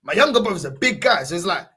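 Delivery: ordinary talk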